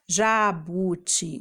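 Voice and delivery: JABUTICABA in five separated syllables. Shown as a demonstration. Teacher showing children what syllables are, JABUTICABA split apart. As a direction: open up each piece, ja-bu-ti-ca-ba, in JABUTICABA. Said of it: "os pedacinhos que a gente abre a boca".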